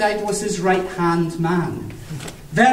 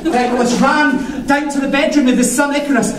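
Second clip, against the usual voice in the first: plain reading voice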